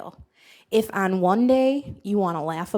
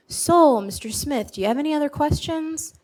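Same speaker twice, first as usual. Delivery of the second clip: higher-pitched, acted doctor's check-in; doctor addressing patient; 6 semitones higher than usual. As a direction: play the doctor asking politely, pitch lifted. Acting out doctor's pre-surgery question.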